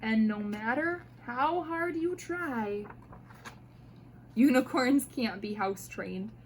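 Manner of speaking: usual speaking voice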